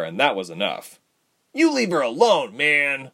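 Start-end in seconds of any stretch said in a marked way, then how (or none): 1.5-3.1 raised, high-pitched warning; protective, high outburst; crying out. A narrator's reading. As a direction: cry out in her defence. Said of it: "Ren cried".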